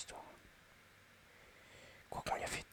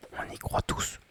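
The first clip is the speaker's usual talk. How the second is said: excited whisper; 9 dB louder, slower than usual. Real, not acted. Hyping up the match.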